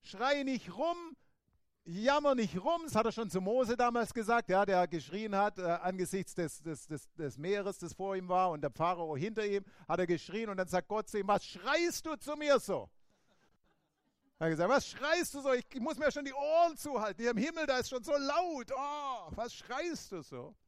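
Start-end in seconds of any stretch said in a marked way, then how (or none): 0.0-2.8 higher, slow, firm encouragement; Jesus nudging them to stop whining; Jesus urging. Retold or imitated. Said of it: "Jesus sagt". 11.3-20.5 higher, mock annoyed complaint; God teasing Moses about the noise; joking God. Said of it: "Das ist ein kleiner Scherz".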